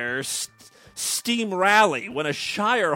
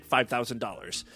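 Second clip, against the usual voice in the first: normal talking voice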